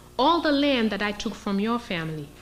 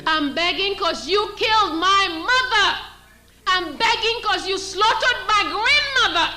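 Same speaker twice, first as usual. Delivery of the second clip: defiant retort; 7 semitones higher, slower than usual. Retold or imitated. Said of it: "We won't even say".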